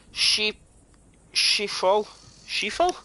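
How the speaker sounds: "sheafol" tried out haltingly, rising into a question; unsure pronunciation attempt. Real, not acted. Gamer stuck on an unknown word, unsure pronunciation. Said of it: "Is that how you say it?".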